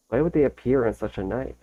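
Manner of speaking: ordinary talk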